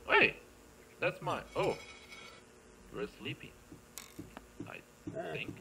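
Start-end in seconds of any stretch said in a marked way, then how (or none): none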